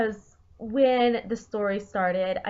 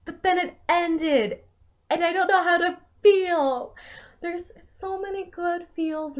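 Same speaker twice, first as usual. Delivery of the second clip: giddy; 7 semitones higher, 5 dB louder than usual. Real, not acted. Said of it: "my smile and my giddy voice".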